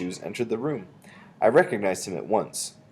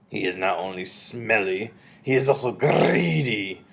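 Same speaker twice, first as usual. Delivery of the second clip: put-on slow, accented witch's sneer; disdainful witch; slower than usual. A narrator's reading. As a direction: draw it out as a scornful witch. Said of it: "said the Grand High Witch".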